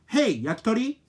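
high, loud questioning call; loud call to the phone. Shown as a demonstration. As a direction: call it like a name. Calling the phone.

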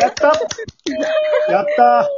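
a burst of real delight; excited cheer. Real, not acted. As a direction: cheer like your side just won. Rooting side wins the poll.

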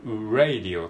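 radio begun with a tiny "u" sound; R with small u. Shown as a demonstration. Teacher explaining word-initial R, starting with a small u. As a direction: lead into radio as "u-radio". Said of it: "ちっちゃいうを、".

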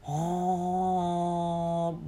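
usual speaking voice

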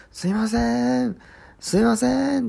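high, drawn-out call through a door; pleading caller. Retold or imitated. Unseen visitor calling from outside the door, pleading. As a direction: call out imploringly, stretching the last syllable. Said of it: "これ必死に訴えかけてるわけですから".

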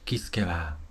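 ordinary delivery, nothing marked

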